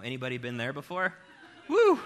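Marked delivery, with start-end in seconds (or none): 1.7-2.0 a high, loud whoop; a big cheer to rouse the congregation; excited whoop. Real, not acted. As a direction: whoop to get the crowd going. Crowd shout after a rhetorical question.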